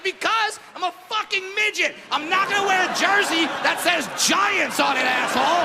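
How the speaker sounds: high, heated retort; angry rant. Retold or imitated. Comic to station bosses, angrily ranting. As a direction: blow up at them.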